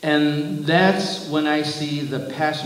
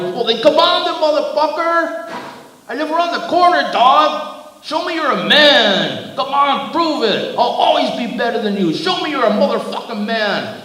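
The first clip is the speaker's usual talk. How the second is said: very high, loud, frantic yelling; enraged hollering; 12 semitones higher, 6 dB louder than usual. A narrator's reading. Giant daring the driver to fight, enraged hollering. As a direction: holler a challenge, completely unhinged. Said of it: "and he hollered".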